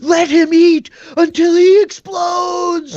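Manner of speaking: high, loud, shouted praise; ecstatic cheering. Acted. Rescued man hails the paladin with ecstatic shouting. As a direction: hail the hero at the top of your voice.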